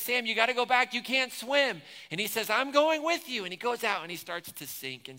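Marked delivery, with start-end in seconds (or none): 0.0-1.8 raised, high urgent call. Retold or imitated. Pastor voicing Frodo warning Sam, high loud call. 2.5-3.4 high, loud stubborn reply; shouting back. Retold or imitated. Pastor voicing Sam refusing to turn back, loud shout.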